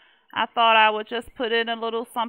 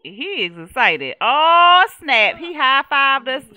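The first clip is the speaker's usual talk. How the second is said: loud thrilled reaction; real excited joy; excited delight; 3 semitones higher, 11 dB louder, slower than usual. Real, not acted. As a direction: celebrate the win loudly. Sim likes house.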